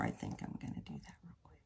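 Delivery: fast whispered aside. Real, not acted. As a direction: whisper it to yourself, quickly. Whispered aside while trimming.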